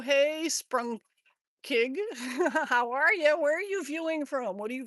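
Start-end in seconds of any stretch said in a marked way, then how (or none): 1.6-2.6 short, hearty laugh; quick burst of laughter. Real, not acted. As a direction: laugh while greeting someone. Laughs while greeting viewers.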